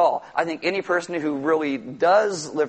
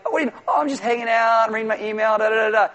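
higher, breezy casual chat; offhand small-talk imitation; casual email voice; 4 semitones higher, faster than usual. Shown as a demonstration. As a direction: toss it off lightly, chatty. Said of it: "if I wrote this to you in an email".

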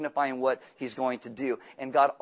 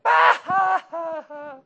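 very high, loud scoffing guffaw; mocking laugh; 9 dB louder, much slower than usual. Abraham scoffing at God's promise, hammed-up laugh. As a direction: burst out laughing at the idea. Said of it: "Just showing it to you".

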